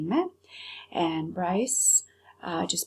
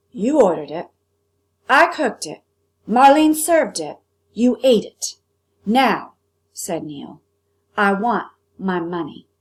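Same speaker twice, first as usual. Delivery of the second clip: angry cook; 9 dB louder than usual. Acted. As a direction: lay it down like a verdict. Cook's angry demand.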